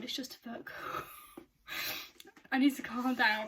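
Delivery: ordinary talk, nothing marked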